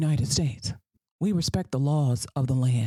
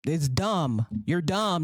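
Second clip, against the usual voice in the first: usual speaking voice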